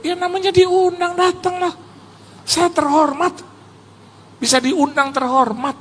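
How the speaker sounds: put-on high voice; exaggerated humble reply. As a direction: play up the modest reassurance. Menirukan jawabannya sendiri. (jawaban rendah hati bersuara tinggi)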